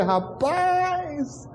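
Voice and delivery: high, drawn-out amazed rapaz; amazed exclamation. Retold or imitated. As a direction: marvel at the gift with a high cry. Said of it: "Eu falei".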